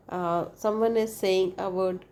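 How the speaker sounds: usual speaking voice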